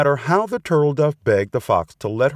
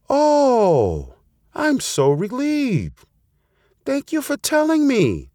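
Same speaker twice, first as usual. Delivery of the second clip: high, drawn-out relief; relieved bird; slower than usual. A narrator's reading. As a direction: gush thanks as a relieved storybook dove. Bird learns she is safe.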